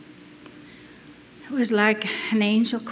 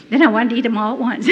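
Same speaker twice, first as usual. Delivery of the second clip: usual speaking voice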